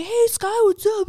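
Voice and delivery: high, bright mock greeting of an acquaintance; mock chirpy greeting. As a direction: put on a squeaky friendly hello.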